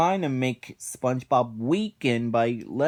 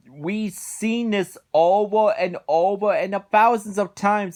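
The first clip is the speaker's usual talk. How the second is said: higher-pitched, louder heated rant; indignant rant; 6 semitones higher, 4 dB louder than usual. Real, not acted. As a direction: vent your outrage at the greedy boss. Ranting about Mr Krabs' greed.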